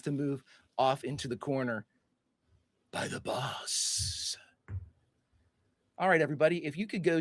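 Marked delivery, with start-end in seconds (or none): 2.9-4.4 very slow, voiceless whisper; whispered clown menace. Acted. Pennywise revealing who told him, slow whisper. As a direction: whisper it, stretching the last word.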